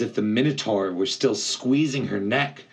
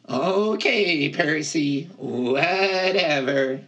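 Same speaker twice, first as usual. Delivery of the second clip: drawn-out whatever, higher; unconvinced satyr; 8 semitones higher, slower than usual. Acted. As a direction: shrug it off, higher voice. Grover voiced, dismissive.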